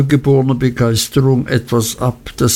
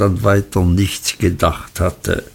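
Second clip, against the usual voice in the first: plain reading voice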